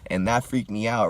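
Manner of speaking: ordinary talk, nothing marked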